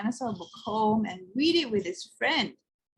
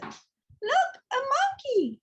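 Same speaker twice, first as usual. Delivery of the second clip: put-on high excited little girl's cry; a child calls out in delight; shouting girl; slower than usual. A narrator's reading. Girl in story hour shouting at the monkey, high and excited. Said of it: "shouted the girl".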